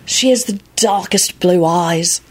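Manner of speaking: slow, entranced reverie; dreamy, drawn-out adoration; trance-like. A narrator's reading. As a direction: linger on each word as if hypnotised. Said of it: "continued in a trance-like voice".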